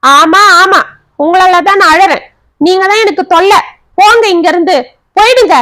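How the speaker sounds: high-pitched, furious dismissal; exasperated shouting, climbing in pitch; angry outburst. A narrator's reading. Acted quarrel, ordering husband away.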